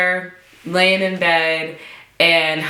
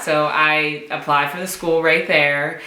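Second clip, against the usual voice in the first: ordinary delivery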